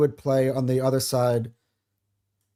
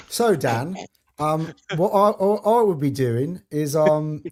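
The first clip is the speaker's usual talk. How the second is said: mock English accent; 3 semitones higher, slower than usual. Shown as a demonstration. Co-host answering Dan in his own accent, playful English accent. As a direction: do your co-host's English accent back at him. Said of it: "that's a decent English accent you got there".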